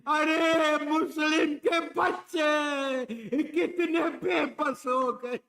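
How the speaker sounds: loud, high wail of grief; lamenting cry. Acted. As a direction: cry out for the helpless children. Preacher cries out in lament.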